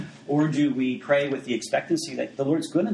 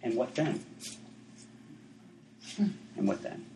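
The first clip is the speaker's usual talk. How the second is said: put-on softer, understated old man's question; gentle retold question; 9 dB quieter than usual. Smiling old man to the boy facing death, gentle. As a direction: drop the voice for a quiet punchline. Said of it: "the old man smiled at him and said".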